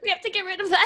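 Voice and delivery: high-pitched frantic voice, half laughing; mock panic. Imitating a friend flushing brownies, laughing mock panic. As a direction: fret it up high, playing it for laughs.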